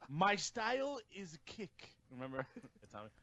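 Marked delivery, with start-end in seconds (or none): 0.1-1.9 slow, higher, softer wrestler impression; Shinsuke impression. Co-host adds the wrestler's remembered line, slow imitation. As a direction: imitate the wrestler, spacing the words out slowly. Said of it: "then Shinsuke went".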